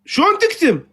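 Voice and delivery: high-pitched indignant question; indignation. Real, not acted. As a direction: protest being muted, pitch shooting up. Protesting at being muted.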